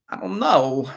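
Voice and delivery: a hint of Christopher Walken; Christopher Walken impression. Shown as a demonstration. Runner trying a Walken line on his own words, light impression. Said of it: "Sounds like Christopher Walken".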